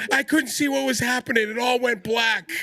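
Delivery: very high mock-panicked cry, slowing at the end; imitating panicked mother. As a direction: retell it as a freaked-out mother. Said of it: "like, freaking out".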